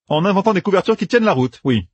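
planning aloud with brisk confidence; a hint of a young general laying out plans; Matt's voice. A narrator's reading. Narrator voices Matt.

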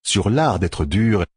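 ordinary delivery, nothing marked

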